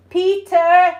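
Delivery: loud call to another room; yelling for her son. Real, not acted. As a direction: shout the name across the house.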